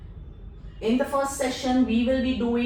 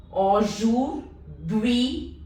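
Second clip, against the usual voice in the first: "aujourd'hui" extra slow, each syllable held; much slower than usual. Shown as a demonstration. Teacher repeating "aujourd'hui" extra slow for the class. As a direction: drag "aujourd'hui" out even slower. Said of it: "U I is pronounced as ui".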